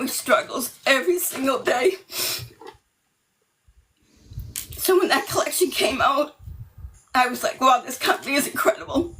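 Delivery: talking about her son, close to tears; emotional. Real, not acted. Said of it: "I'm gonna get emotional".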